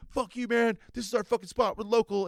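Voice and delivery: high-pitched indignant local surfer; mock territorial anger. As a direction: defend your turf like a worked-up bro. Acted local surfer's retort.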